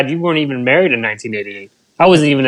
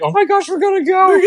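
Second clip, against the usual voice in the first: tearful shout. Retold or imitated. Retelling crying before the win.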